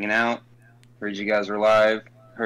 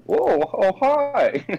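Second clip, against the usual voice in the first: high-pitched, goofy drawn-out greeting; Jimmer's Reddit voice; 12 semitones higher, much slower than usual. Acted. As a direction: swoop way up into a corny hello. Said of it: "That's his Reddit voice impression".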